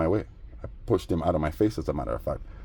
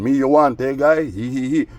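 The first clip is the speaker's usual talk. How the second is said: teasing taunt; 5 semitones higher, 4 dB louder than usual. Retold or imitated.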